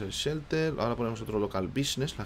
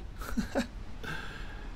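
soft airy chuckle; breathy laugh; 9 dB quieter than usual. Real, not acted. Laughs at the chaos. (risa ahogada)

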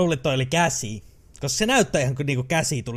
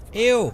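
loud, high disgusted "Ew!"; 8 semitones higher, 5 dB louder than usual. Real, not acted. Player grossed out by flying brains, loud ew. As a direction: recoil in real disgust.

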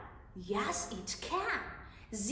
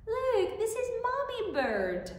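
high excited voice; 9 semitones higher than usual. Shown as a demonstration. Cheerful child-directed voice.

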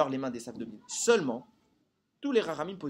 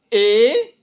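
"et" loud, high and drawn out; stress on ET; 6 semitones higher, 14 dB louder than usual. Shown as a demonstration. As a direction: hammer the word "et" and stretch it. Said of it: "pas ou".

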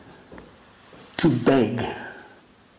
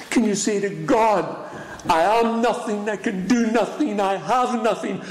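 impassioned preaching; 8 semitones higher than usual. Acted. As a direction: plead to God at full stretch. Preacher voicing a prayer.

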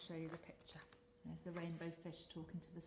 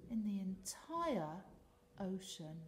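usual speaking voice

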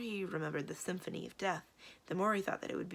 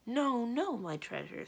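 raised, gently protesting doubt; 6 semitones higher, slower than usual. Acted. Magician to his beloved, doubting her confession. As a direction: protest fondly, with mild doubt. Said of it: "said the magician doubtingly".

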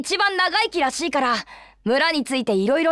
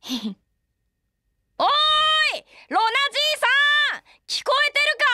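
shouting; 10 semitones higher than usual. Acted. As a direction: yell so a deaf man hears. Shouting to deaf old man.

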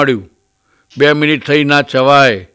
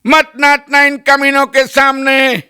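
slow, high film-hero plea; hammed-up Hindi film dialogue, drawn out; Dharmendra; 8 semitones higher, slower than usual. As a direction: mimic a tied-up movie hero's dramatic cry. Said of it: "Dharmendra કહે છે".

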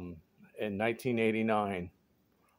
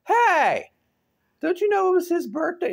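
high, loud call to get attention; friend calling out; 5 dB louder than usual. Retold or imitated. Friend tells the girl it's his birthday, high loud call. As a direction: shout it across the room for your buddy. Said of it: "your friend turns around and goes".

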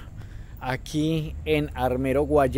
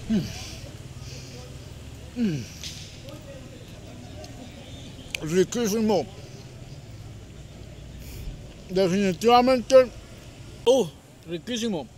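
chewing while talking; muffled words between bites; mouth full; 5 semitones higher, 4 dB quieter than usual. Real, not acted. Seen 0: cheeks puffed, lips pursed, food on lip while speaking, plate held.

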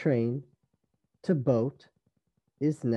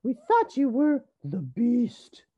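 high character voice; little sisters' voices; 11 semitones higher, 4 dB louder than usual. A narrator's reading. Sisters explain their scare, high little voices. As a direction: exclaim it high and emphatic. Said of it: "said the sisters".

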